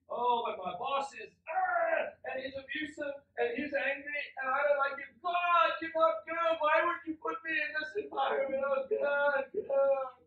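whiny complaint. Retold or imitated. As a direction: whine at God like a spoiled believer. Believer complains to God.

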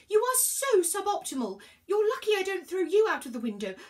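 high, loud indignant rant; high-pitched fed-up outburst; exasperated scolding. A narrator's reading. Virgo scolds the useless parchment.

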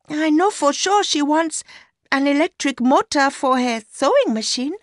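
put-on high-pitched young woman's voice; Mercy, young mistress. A narrator's reading. Mistress telling her lover about the new shoes, high young woman's voice.

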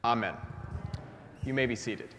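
ordinary delivery

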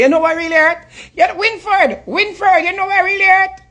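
pleading falsetto mock of a wounded lover; mock Aisha. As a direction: squeak it out like a lovesick woman moaning to her man. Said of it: "We tap use a voice, they can say it annoying".

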